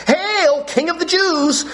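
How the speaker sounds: high, jeering salute; mock hail. Soldiers saluting Jesus in high mock praise. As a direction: hail him as a joke king. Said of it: "And they said".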